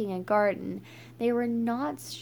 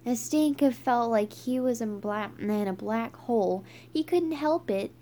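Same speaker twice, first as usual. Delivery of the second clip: ordinary delivery, nothing marked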